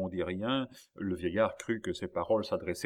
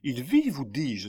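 high excited cry, rising to loud; exclaiming; 5 semitones higher, 4 dB louder than usual. A narrator's reading. Young man feeling a heartbeat in the boy, crying out. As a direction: build up to an insistent outcry. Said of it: "s'écria Philippe".

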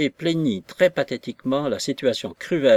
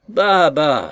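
loud, scoffing bah; louder mocking scoff; scornful dismissal; 4 semitones higher, 7 dB louder than usual. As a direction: brush her words off with contempt. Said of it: "s'écria monsieur Weston".

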